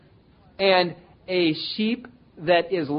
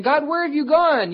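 much higher mock whine; whining to God; 10 semitones higher, 5 dB louder than usual. Preacher imitating believers whining to God, much higher. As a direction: pitch the plea up like a sulky child. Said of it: "and we start whining".

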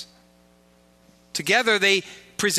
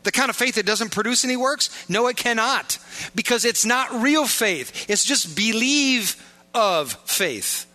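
preaching at full force; 5 semitones higher than usual. Acted. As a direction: press it home to the congregation.

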